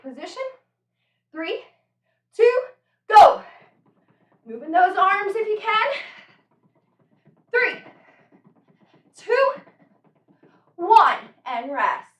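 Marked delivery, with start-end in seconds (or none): 1.3-11.2 loud, higher coaching calls; barking gym coach. Real, not acted. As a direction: pump them up with counts. Coach counting down the drill.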